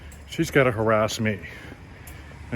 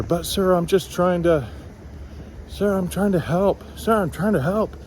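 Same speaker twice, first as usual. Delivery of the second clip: high, pleading tout voice; mock insistent tout; 8 semitones higher than usual. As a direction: wheedle like a persistent hustler. Tout pleading with annoyed tourist.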